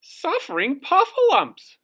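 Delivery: very high, loud screeching puffin; cartoon puffin screech. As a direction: shriek it like a startled puffin. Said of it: "screeched Puffin Muffin".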